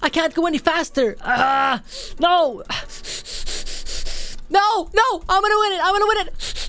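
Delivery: high-pitched strained cries; frantic desperation. Real, not acted. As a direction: cry out while clicking for dear life. Losing tug of war, straining.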